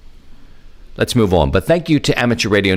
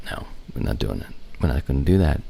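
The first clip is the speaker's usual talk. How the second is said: low, soft, quick refusal; muttering to himself; 6 semitones lower, 8 dB quieter, faster than usual. Real, not acted. Host declining the wifi terms, muttering to himself. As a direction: mumble it under your breath.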